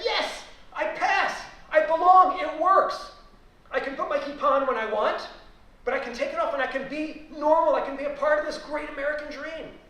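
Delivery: loud, high exultant declaration; triumphant. Retold or imitated. A young academic glad to pass as American, triumphant. Acting out his inner triumph.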